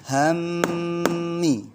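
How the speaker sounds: Hammi, mm ditahan lama dan tinggi; held gunnah. Shown as a demonstration. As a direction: dengungkan mm di Hammi dua ketukan. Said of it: "Bacanya bagaimana". (Hammi dengan dengung panjang)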